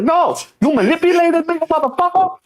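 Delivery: very high, strained mock wail; mock hysterical woman. Imitating Amanda accusing him of manipulation, in falsetto. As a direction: shriek the betrayal in falsetto.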